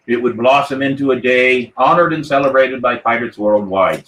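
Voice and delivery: ordinary talk, nothing marked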